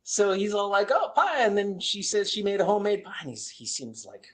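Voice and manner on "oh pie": high-pitched imitation of delight; mock delight. Old man reacting to his wife's pie, mock delight.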